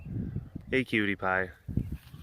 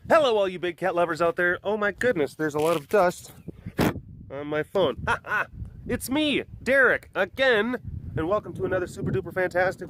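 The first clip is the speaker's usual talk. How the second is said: bouncy, higher-pitched show opening; webcast host intro; 4 semitones higher than usual. Acted. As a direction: greet the viewers with hammed-up hype.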